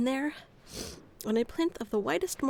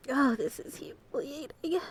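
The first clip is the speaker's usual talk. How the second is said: soft, mortified whisper; a whispered groan of embarrassment; embarrassed whisper; 4 dB quieter than usual. Acted. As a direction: whisper it in shame.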